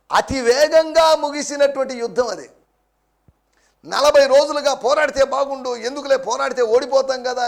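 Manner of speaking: rising pulpit intensity; forceful preaching. Acted. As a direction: drive the point home from the pulpit. Preacher builds to full force.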